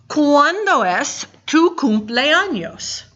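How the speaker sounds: careful teacher model; slow model question. Shown as a demonstration. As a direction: present it slowly to the class. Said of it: "We have a new question word".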